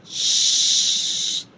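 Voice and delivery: Shh held very long and loud; French CH. Shown as a demonstration. Teacher answering for C H in French, long hush. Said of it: "what do you say?".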